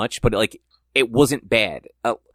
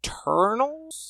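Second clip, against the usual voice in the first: high questioning; 4 dB quieter, much slower than usual. Real, not acted. Stretched high questioning name.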